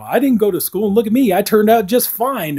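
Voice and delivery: imitating Fester. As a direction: brag cheerfully, up in the voice. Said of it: "Uncle Fester is like".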